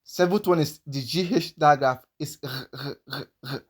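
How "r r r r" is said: "r" as an isolated GH sound; soft, repeated "r" for the GH digraph; sound demo. Shown as a demonstration. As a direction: sound out "r" softly, in separate short bursts. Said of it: "That's how it is pronounced".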